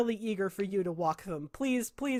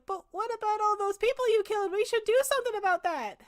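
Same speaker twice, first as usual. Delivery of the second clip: much higher, fretful voice from the crowd; worried villager; 12 semitones higher than usual. Acted. A villager in the back objects to the party, high and fretful. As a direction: pipe up anxiously from the back.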